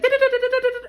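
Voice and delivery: high, loud rushing da-da-da; rapid-fire babble. Retold or imitated. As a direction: rattle it off high and loud like an impatient spirit. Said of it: "Spirit just wants to be like".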